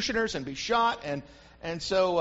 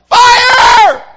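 very high, very loud scream; 19 dB louder than usual. Retold or imitated. Third prisoner screaming at the squad, loud scream. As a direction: yell it as the punchline. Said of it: "screams out".

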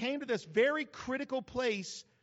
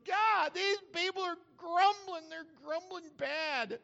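high-pitched, mock pleading wail; crying out; 8 semitones higher than usual. Moses crying out to God about grumblers, hammed-up plea. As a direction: plead upward in an exaggerated high voice. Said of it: "He cried out to God".

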